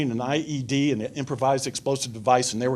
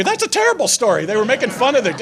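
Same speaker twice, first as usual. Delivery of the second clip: much higher, louder, fast mock protest; indignant objection; 9 semitones higher, 5 dB louder, faster than usual. People objecting to his story, mock indignant and loud. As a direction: play the offended listener.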